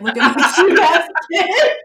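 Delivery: high, loud laughing parent brag; mock the bragging parent while laughing; laughing mock proud parent. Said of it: "they're always like".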